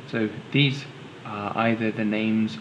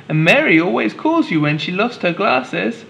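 mum voice; 10 semitones higher, 4 dB louder than usual. Acted.

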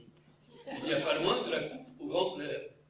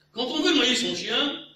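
Quand on veut noyer son chien, higher, louder and slow, hanging; mock grand opening; 8 semitones higher, 9 dB louder than usual. Proverb-lover forgets the ending, grand hanging recital. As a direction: start the proverb grandly then stall. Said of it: "soufflez-moi".

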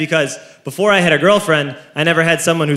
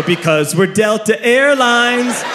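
slow, higher grand proclamation; hammed-up evil airline slogan; villainous gloating; 5 semitones higher, slower than usual. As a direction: declare it with drawn-out relish. Villainous staff explain themselves.

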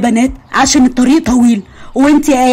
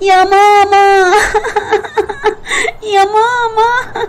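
put-on whining child; 8 semitones higher, slower than usual. Child wailing to mother, crying.

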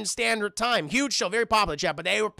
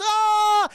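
high, loud drawn-out scream; mock scream; 12 semitones higher, 7 dB louder than usual. She is carried in a jump, high mock scream. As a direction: scream as if falling, played up.

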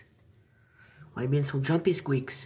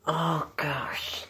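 breathy gasp through the pain; hissing in pain; 2 semitones higher, 4 dB louder than usual. Real, not acted. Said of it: "I stubbed my toe on that".

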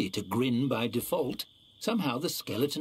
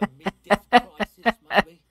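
laughing; 7 semitones higher, 11 dB louder than usual. Acted. Skeleton laughs about his crisis.

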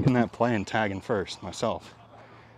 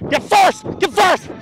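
shouting; 11 dB louder than usual. Real, not acted. Yelling to teammate on field.

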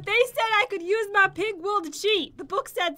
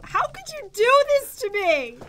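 ordinary talk

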